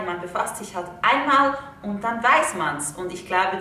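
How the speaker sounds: ordinary delivery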